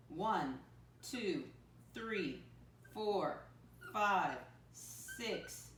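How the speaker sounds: put-on slow, even counting for young learners; counting aloud for kids. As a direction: count each cube out deliberately. Counting along with the class.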